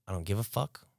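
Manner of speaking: usual speaking voice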